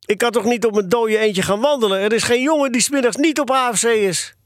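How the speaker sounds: put-on high-pitched boyish pleading; aggrieved wail to mother; plaintive protest. A narrator's reading. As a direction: argue like an upset, wronged boy. Acted boy pleading with his mother.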